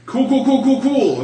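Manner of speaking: high, loud repeated cool; excited cool cool. Retold or imitated. Player mocks a teammate's loud, high excitement. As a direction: rattle off the cools, high and loud. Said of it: "is what he said".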